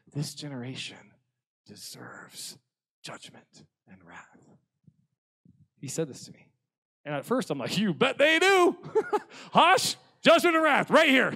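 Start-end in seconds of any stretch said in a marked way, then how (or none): 0.1-4.4 grave, hushed pronouncement sinking low; slow, solemn divine verdict, trailing softer; God's voice. Retold or imitated. God speaking judgment to youth pastor, grave divine voice. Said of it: "I heard his voice go". 7.8-11.3 loud, high vengeful yell; hurt and angry. Retold or imitated. Angry youth pastor egging God on, mock furious shout. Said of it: "I was hurt and I was angry".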